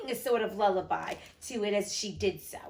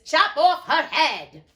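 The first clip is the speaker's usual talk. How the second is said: much louder, higher, slow with a laugh; cackling execution order; 6 semitones higher, 11 dB louder, slower than usual. A narrator's reading. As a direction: gleefully bellow the order to behead her.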